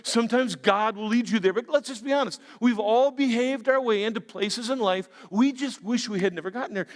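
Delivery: ordinary talk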